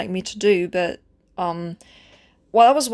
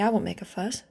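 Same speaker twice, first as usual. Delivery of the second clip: softer, almost under the breath; hushed; 8 dB quieter than usual. Acted. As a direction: let it sink to a hush. Repeats promise, second time hushed.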